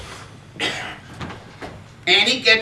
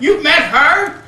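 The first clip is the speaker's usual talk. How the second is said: put-on amazed child; 5 semitones higher, 4 dB louder, slower than usual. Boy amazed father knows Ethel.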